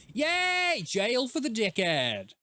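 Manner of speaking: high happy yay, then slow gloating; real delight at the arrest; excited cheer. Real, not acted.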